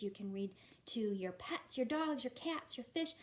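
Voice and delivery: normal talking voice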